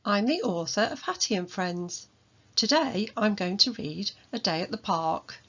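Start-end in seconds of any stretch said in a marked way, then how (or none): none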